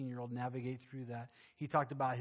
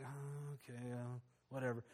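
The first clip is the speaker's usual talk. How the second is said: quiet shrug; 8 dB quieter than usual. Retold or imitated. Muttered reaction to the stranger.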